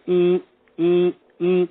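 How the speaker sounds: repeated held "ee" meter sounds; imitated meter noise. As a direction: mimic the meter reacting each time. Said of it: "my vibrational meter would go".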